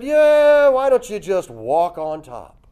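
loud yeah then slow easy suggestion; lightly voiced God, offhand; casual God voice. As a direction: play God as a relaxed buddy.

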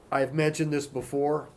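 ordinary talk

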